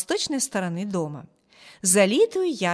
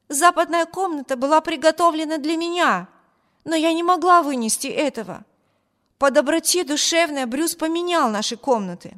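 high, louder, fervent explanation; heated defence; 7 semitones higher, 4 dB louder than usual. A narrator's reading. Sister explains the room swap to her brother, heated. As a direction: press the point earnestly and hotly. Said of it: "горячо воскликнула Эми".

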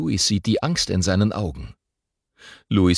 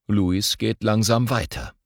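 ordinary delivery

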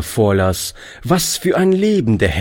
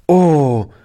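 put-on higher, louder boy's "Oh"; exclaiming; 8 semitones higher, 4 dB louder than usual. A narrator's reading. Boy Toni crying out at the news, higher and louder. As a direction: cry it out in dismay. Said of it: "rief Toni".

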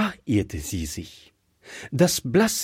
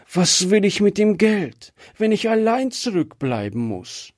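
sobbing; 8 semitones higher than usual. Acted. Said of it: "schluchzte sie".

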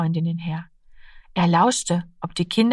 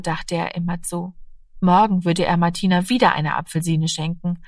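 ordinary delivery

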